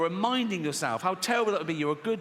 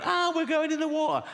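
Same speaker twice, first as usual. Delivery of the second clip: high, shrill-edged mock cry of alarm; mock panic; 11 semitones higher than usual. People fleeing the sea, high mock panic. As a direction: squeal like a beachgoer fleeing the water.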